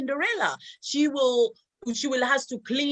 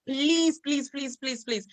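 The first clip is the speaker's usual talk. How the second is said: pleading prayer; slower than usual. Retold or imitated. Praying to God to leave.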